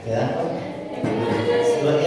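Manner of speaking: very slow, sweeping up high; drawn-out question. Real, not acted. Priest asking his congregation if they see, very slow and high. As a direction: stretch it out and lift it high to the congregation. Preacher drives point home.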